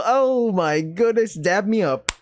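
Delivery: high, slow, stretched-out gush; excited. Acted. Hyped friend gushing at the answer about Jill.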